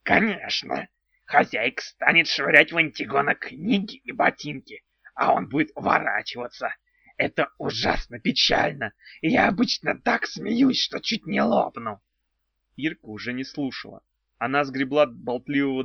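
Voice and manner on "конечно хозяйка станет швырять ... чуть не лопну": enthusiastic odd goblin voice; eager goblin. A narrator's reading. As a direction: goblin bursting with excitement. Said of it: "с воодушевлением закивал".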